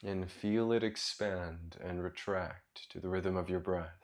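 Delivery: soft, low meditation voice with long pauses; guided meditation. Real, not acted. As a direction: guide them gently into their breath, quietly and low.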